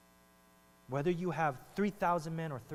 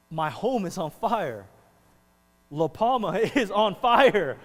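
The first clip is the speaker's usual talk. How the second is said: dismayed soldier; 4 semitones higher, 9 dB louder than usual. Pretend. Soldier sees home burning.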